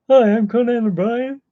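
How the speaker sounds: high, loud talk-show host voice; Conan O'Brien. Retold or imitated. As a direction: greet like a late-night host. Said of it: "I'm doing a Conan O'Brien".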